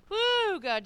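usual speaking voice

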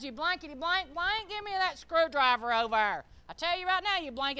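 hammed-up family snapping; mock angry dad. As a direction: imitate a cussing dad demanding a tool. Acted rude dad ordering his son.

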